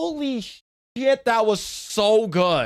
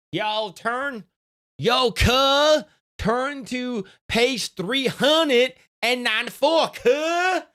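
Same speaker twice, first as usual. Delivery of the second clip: higher, street-tough hype; tough bully voice; 6 semitones higher, much slower than usual. Pretend. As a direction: bark orders at the class like a thug. Playing a tough school bully.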